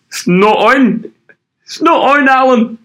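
very high, loud, drawn-out protest; mock outraged squawk. Protesting to Alan on a sports show, hammed-up outrage. Echoes the joke, addressing Alan.

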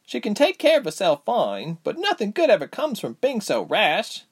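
high, urgent character voice; worried young heroine. Acted. Reshma fretting over rash Ilse, high and worried.

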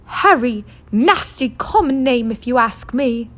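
high, disdainful wife's voice; sneering wife. Acted. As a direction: sniff at the common name. Wife sneers at nephew's name.

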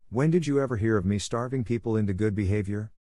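usual speaking voice

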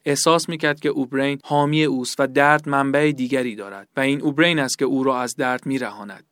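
ordinary delivery

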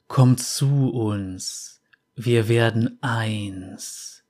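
very slow, distant ghostly whisper; muffled drifting fog spirit. Acted. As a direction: lure him slowly, voices from the mist. Voices in the fog.

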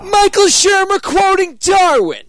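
high excited shout. Acted. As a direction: announce it like a showman. Shouted joke attribution.

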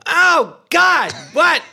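high, loud mock yell of horror; shocked cry. Blind man crying out once the lights go out, high shocked yell. Retold second shock in the bed.

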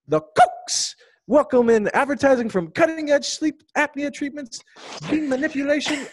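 high, hammed-up Aussie voice reading a review; over-the-top Aussie review read; Australian accent. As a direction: put on a bouncy Australian accent. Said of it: "I want you to do an Australian accent".

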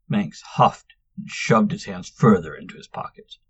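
usual speaking voice

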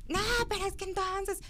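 high, whiny mock teen objection; imitating a student arguing back; protesting student. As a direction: protest in a high kid's whine. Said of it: "Y entonces empezaban".

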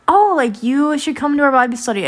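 high, gushing imitation of friendly girls; eager invitation. Girls inviting a student to Bible study, eagerly. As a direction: lift the voice high and invite keenly.

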